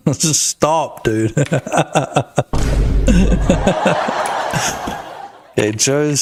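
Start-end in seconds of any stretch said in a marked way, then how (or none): 1.4-5.3 long breathy burst of laughter; real amused laughter; laughing. Real, not acted. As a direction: laugh on and on, helplessly. Amused by deep-voice remark.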